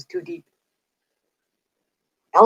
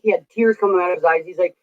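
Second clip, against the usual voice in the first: ordinary talk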